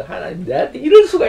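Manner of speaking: high, loud mock protest; defiant outburst at God. Preacher acting his old self railing at God, loud and high. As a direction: shout it up at heaven, shaking a fist. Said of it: "막 삿대질을 하면서".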